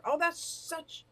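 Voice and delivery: ordinary talk, nothing marked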